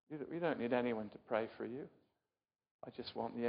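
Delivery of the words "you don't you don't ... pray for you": usual speaking voice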